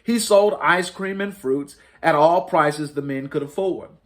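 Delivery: usual speaking voice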